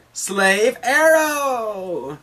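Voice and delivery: high, long, drawn-out cry; attack call. Acted. Hancock attacks out of nowhere, drawn-out battle cry. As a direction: call out your signature move.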